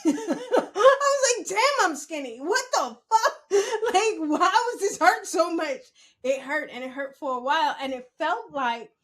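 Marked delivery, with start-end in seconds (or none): 0.1-5.8 high, loud laugh-crying. Real, not acted. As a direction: laugh so hard it almost cries. Laughing crying about the pain.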